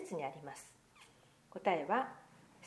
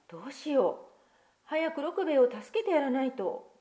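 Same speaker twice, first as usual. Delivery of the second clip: worried; 6 semitones higher, 6 dB louder than usual. Acted. Children worried for dog.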